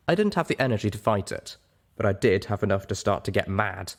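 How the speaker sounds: usual speaking voice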